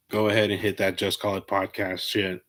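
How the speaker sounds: ordinary talk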